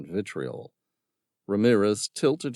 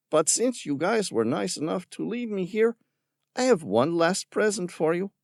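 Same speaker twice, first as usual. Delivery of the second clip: mocking villain; 11 semitones higher than usual. A narrator's reading. Villain scorning Tom's concern for the creatures. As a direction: sneer at the tree hugger. Ramirez voiced.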